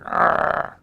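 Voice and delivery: a quick hammed-up frustrated roar; angry growl. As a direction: grunt it out like frustrated anger. Turn everything into anger.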